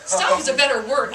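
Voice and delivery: usual speaking voice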